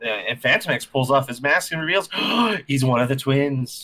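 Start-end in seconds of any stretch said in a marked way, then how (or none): 2.2-2.6 mock gasp. Mask reveal, mock shock.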